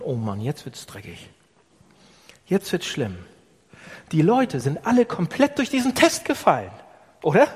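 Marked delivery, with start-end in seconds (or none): 0.0-3.2 softer, dismayed inner voice; worried thought. Retold or imitated. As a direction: mutter the dread to yourself. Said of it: "Mose hat schon gedacht". (Mose denkt besorgt, leiser)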